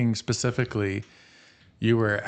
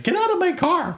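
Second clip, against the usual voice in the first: mock angry yell; 3 dB louder than usual. Stunned driver orders stranger out of car, mock yell. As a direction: shoot way up high for a retold get out.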